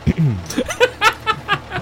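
high, real laugh; burst of laughter. Real, not acted. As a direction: crack up at the players. GM laughs at players pressing everything.

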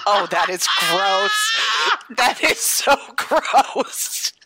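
slow, laughing groan of disgust. Real, not acted. Cohost laughs in disgust at the joke.

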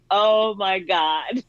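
long drawn-out, amazed reliving of shock; stunned exclamation. Retold or imitated. Guest retelling her reaction to Tyler Perry's call, stunned and drawn out. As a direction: stretch each word out slowly in disbelief.

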